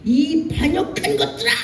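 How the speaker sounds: high, sharp scolding cry; 격분한 지도자의 호통; mock furious yell. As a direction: scold the rebels like an enraged leader. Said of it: "모세도 이렇게 하는 거예요".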